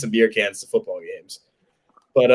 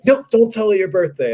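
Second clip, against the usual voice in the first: high-pitched mock objection; cop cutting in; 9 semitones higher than usual. Cop interrupts the call to mom, high mock objection.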